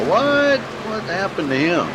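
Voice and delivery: sudden high startled question; surprised. Real, not acted. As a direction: caught off guard, blurting out. Car suddenly out of race.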